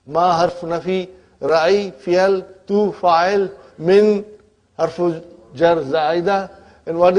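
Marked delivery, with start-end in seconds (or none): none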